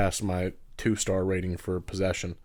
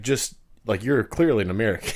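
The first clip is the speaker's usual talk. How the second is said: ordinary talk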